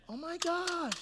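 put-on high gushing girlish voice; lovesick woman. Preacher playing a lovestruck woman, high gushing. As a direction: gush like she's in love. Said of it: "So she's thinking emotionally".

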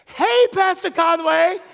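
high, slow, bright hello; cheery waitress greeting. Retold or imitated. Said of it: "So she says".

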